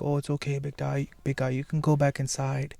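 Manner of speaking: soft, hushed, coaxing coo. Retold or imitated. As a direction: lull him back inside gently. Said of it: "she comes up like".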